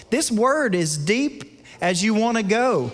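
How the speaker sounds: a pastor's rising climax to the church; full-force preaching. Acted. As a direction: preach it at the peak, nearly shouting. Sermon climax.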